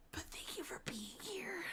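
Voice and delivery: soft unvoiced thanks; whisper. Real, not acted. Streamer quietly thanking a viewer, whispered.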